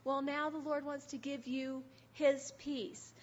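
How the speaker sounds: ordinary delivery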